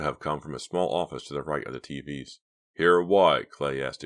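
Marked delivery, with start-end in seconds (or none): 2.7-3.5 loud, irritated question; impatient retort. A narrator's reading. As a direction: snap back, annoyed.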